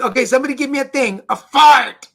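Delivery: fast, then a loud "a fart"; kid heckler shouting out. Retold or imitated. Improv kids shouting rude suggestions at the performer. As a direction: rattle off the ask, then yell "a fart". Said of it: "it's like".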